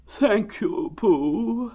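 very slow, high, drawn-out mournful donkey; gloomy Eeyore. Acted. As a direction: drag it out like a sad old donkey. Eeyore thanks.

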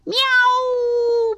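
high, drawn-out happy meow; sing-out kitten mew. A narrator's reading. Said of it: "purred Snowball".

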